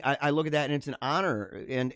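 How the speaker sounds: normal talking voice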